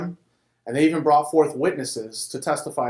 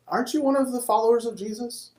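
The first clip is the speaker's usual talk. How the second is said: put-on servant girl; 10 semitones higher than usual. A narrator's reading. Servant girl questions Peter.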